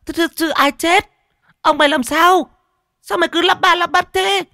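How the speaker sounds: very high, loud screeching scolding; furious mistress, piercing and impatient; shrieking fury. A narrator's reading. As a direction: screech at the stammering servant. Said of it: "rồi miệng bà rít lên".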